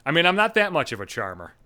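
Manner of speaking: ordinary talk, nothing marked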